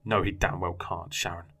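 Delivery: usual speaking voice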